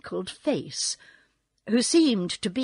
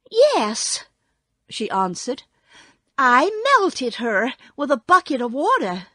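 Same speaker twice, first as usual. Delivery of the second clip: put-on high, louder girl; girl's voice; 4 semitones higher, 6 dB louder than usual. A narrator's reading. Dorothy telling Oz she melted the witch, high voice. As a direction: answer high and emphatic as a girl.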